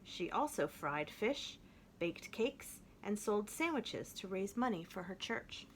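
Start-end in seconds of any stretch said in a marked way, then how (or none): none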